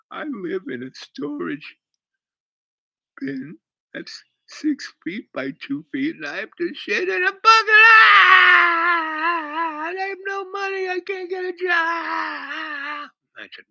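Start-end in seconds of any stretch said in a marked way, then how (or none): 0.1-6.2 slow, high, halting tearful imitation; mock weeping. Weeping woman explaining where she lives, mock tearful. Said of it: "And she's now weeping". 6.2-13.1 very high wail breaking into a loud drawn-out howl; mock bawling. Woman pouring out her troubles to him, hammed-up bawling. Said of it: "And she's now weeping".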